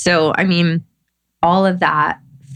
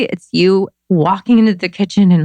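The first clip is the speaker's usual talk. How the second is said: normal talking voice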